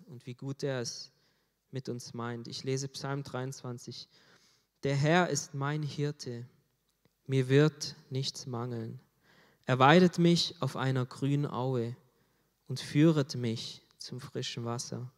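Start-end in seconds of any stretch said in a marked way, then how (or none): 4.8-15.1 solemn scripture reading. Acted. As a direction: read each line like a proclamation. Reads Psalm 23 aloud.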